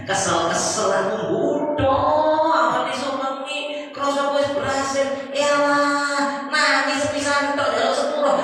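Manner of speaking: high, drawn-out whine of complaint; exasperated devil. Acted. Devil griping that his victim repented, high exasperated whine. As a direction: wail it slowly like a cheated devil.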